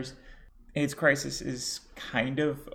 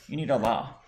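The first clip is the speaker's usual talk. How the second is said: sarcastic; 3 semitones lower, 3 dB louder than usual. Acted.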